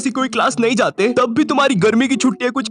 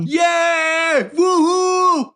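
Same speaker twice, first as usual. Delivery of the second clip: high, long drawn-out whoop; mock cheer; 7 semitones higher, much slower than usual. Cheering the arrival of the sponsor, high mock whoop. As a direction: stretch out a celebratory whoop.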